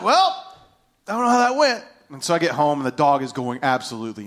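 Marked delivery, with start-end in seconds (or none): 0.0-1.8 high-pitched, comically unsure retelling; pitched-up comic aside; comic doubt. Retold or imitated. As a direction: shrug it off in a raised, mock-sheepish voice. Retells his awkward reaction, now comically.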